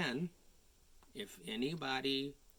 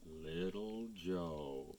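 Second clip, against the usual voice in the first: slow, softer closing; slow ending; 7 semitones lower, 6 dB quieter, much slower than usual. A narrator's reading. Narrator finishing the tale slowly and softly. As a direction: draw it out to close the story. Story's gentle closing line.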